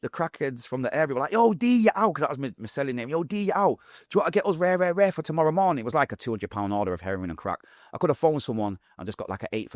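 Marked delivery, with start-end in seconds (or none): 1.3-2.1 high, loud street call; crackhead calling out. Retold or imitated. Crackheads calling him by name. 3.1-5.8 high, eager street request; crackhead asking. Retold or imitated.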